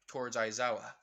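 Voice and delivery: ordinary delivery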